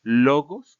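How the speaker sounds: "Logos" with LO drawn out and loud; stress on penultimate syllable. Shown as a demonstration. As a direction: lean hard on the first syllable of "Logos". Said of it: "el acento agudo está en la penúltima sílaba".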